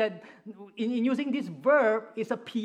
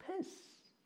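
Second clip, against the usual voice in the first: whispered; 10 semitones higher, 16 dB quieter than usual. Acted. Jonah was angry.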